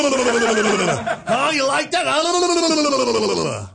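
high, loud, laughing mock come-on; mocking host impression. Mocking the host's rush at the guest, loud crass impression. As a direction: play the overeager host at full tilt. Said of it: "buries his face in it".

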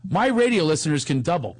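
ordinary talk, nothing marked

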